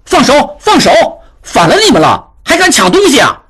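high-pitched angry shout; roaring. A narrator's reading. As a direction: bellow at thieves to let go. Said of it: "赶过去大喝道".

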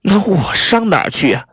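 high-pitched, spiteful retort; vicious. A narrator's reading. Spurned spouse challenging Neville, high and vicious. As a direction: throw the question back venomously, pitched high. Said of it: "凯恶狠狠地说道".

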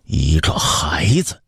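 slow, whispery scorn; dismissive refusal. Acted. Emperor flatly refuses his young son, slowly and dismissively. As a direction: dismiss him slowly, with little voice. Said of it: "刘启断然拒绝".

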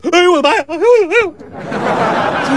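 high-pitched panicked wailing; scared out of his wits, voice shooting up; terrified yelp. A narrator's reading. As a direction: yelp in comic terror. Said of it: "金二胖子吓得黄金入库了".

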